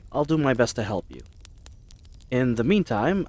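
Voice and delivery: normal talking voice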